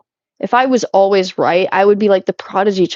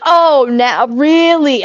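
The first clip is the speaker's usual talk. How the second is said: higher, louder mock retort; mock incredulous; 7 semitones higher, 5 dB louder, much slower than usual. Streamer mimicking his comeback in an awkward conversation, mock incredulous. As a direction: act out his incredulous comeback, pushed up high.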